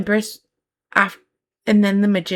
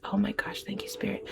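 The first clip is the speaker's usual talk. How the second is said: hushed whisper; 12 dB quieter than usual. Real, not acted. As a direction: breathe the words out, awed. Tarot reader's hushed aside.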